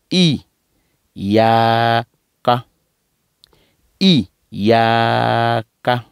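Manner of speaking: "Iyaka" with pressed y and long level aa; "Iyaka" slowly, as iyyaa-ka; pressed, stretched ya. Shown as a demonstration. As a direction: hold the yaa of "Iyaka", short ka at the end. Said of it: "avec ya tiré, avec app-, appu-, en appuyant".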